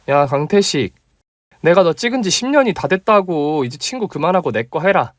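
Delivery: put-on bold young woman insisting; female friend demanding. Female colleague voiced. (높고 다그치는 여자 목소리)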